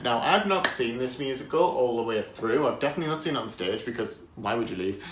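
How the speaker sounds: normal talking voice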